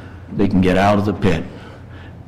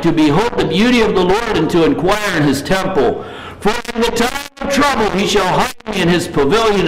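scripture reading; 7 semitones higher than usual. Acted. Preacher reading the psalm to his congregation, reverent and higher. Reads Psalm twenty-seven.

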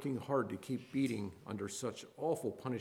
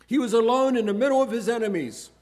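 loud, rising preacher's cry; fervent preaching; 10 semitones higher, 13 dB louder than usual. Acted. Preacher pressing the lonely Christ on the congregation, loud and fervent. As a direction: thunder it from the pulpit.

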